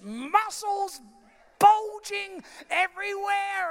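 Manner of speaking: excited hype, voice lifted high; hyped montage retelling. Retold or imitated. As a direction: pump it up with big emphasis.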